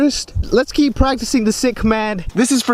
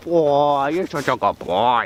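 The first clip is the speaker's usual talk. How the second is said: talking to a dog; 4 semitones lower, slower than usual. Real, not acted. Praising the dog.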